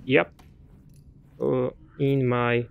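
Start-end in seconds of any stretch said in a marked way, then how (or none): none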